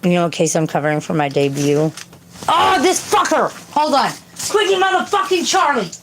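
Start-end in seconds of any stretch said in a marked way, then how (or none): 2.5-5.9 high-pitched real scolding yell; exasperated yelling. Real, not acted. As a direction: holler furiously at a misbehaving pet. Yelling at misbehaving cat.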